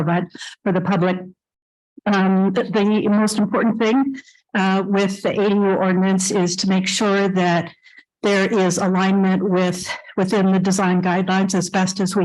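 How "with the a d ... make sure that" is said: ordinary talk, nothing marked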